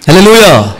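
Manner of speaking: loud ringing hallelujah; hallelujah shout. Real, not acted. Preacher rousing the congregation, loud call. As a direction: call it out to the whole church.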